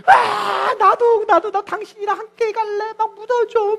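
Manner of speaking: high, crying wail of grief; retold husband's hammed-up crying plea; sobbing husband. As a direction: sob it out, begging to be buried with her. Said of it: "계속 울면서 그러더라는 거예요".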